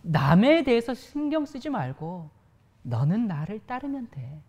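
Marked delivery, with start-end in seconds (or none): none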